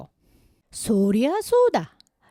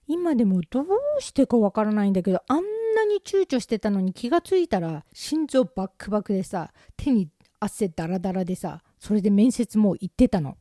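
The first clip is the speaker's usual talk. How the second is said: normal talking voice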